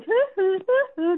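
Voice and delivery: high-pitched quick "hoo" calls; imitated bird hoots. Guest imitating her bird calling to her from the bench, high hoots. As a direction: hoot like a bird calling to be fetched. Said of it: "so he was going".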